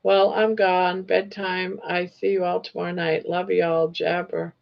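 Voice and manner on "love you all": ordinary delivery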